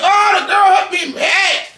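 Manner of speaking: yelling it out as he tells the story; high-pitched retold shout. Retelling a fight at the club.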